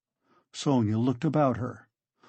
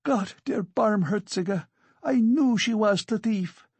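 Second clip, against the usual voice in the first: high-pitched scandalized outcry; crying out; 9 semitones higher than usual. A narrator's reading. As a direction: exclaim it high, in alarm. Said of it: "cried Amalia Ivanovna".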